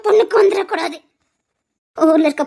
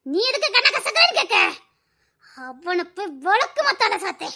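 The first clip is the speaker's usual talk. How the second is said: ordinary talk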